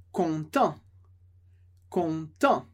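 "content" ending on a nasal vowel, no T; silent T. Shown as a demonstration. Teacher shows the masculine, T left silent. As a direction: say "content" with no T at the end. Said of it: "Sem pronunciar o T".

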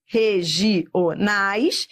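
REGIONAIS slowly split, re-gi-o-nais; syllable by syllable. Shown as a demonstration. Teacher showing vowel encounters in regionais, syllable by syllable. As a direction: break regionais into spaced syllables.

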